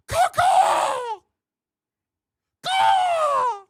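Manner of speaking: shrill high seagull squawk; seagull caw. Pretend. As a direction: caw like a gull at top volume. Cheering for "Tony Seagulls.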